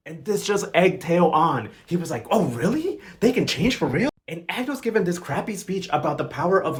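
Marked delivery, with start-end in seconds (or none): 2.3-4.1 higher, wide-eyed retold question; mock a gullible, hopeful listener; hopeful disbelief. Acting Teo, egged on by advice.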